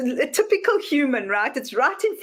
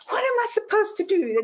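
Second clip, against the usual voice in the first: much higher, lost plea; puzzled plea; 11 semitones higher than usual. Retold or imitated. Confused person asking the universe, high plea. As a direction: ask helplessly, voice way up.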